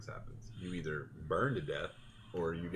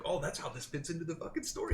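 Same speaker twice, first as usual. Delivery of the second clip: excited realization; 9 semitones higher than usual. Retold or imitated. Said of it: "I was like".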